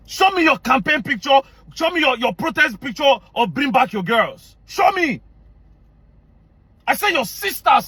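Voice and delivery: high-pitched, insistent demand; heated, emphatic challenge; indignant demand. Real, not acted. Angrily demanding proof from critics.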